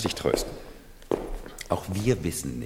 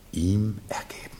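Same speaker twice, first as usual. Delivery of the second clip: whisper. Acted. Ironic aside about loyalty.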